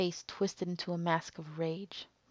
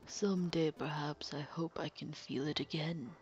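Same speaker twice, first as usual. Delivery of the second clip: calm sinister villain. Acted. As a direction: purr the confession like a cold killer. Villain's wistful menace.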